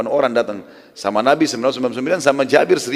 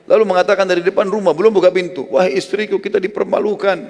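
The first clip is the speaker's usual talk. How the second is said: panicked; 8 semitones higher, 4 dB louder than usual. Acted. Jabir runs home panicking.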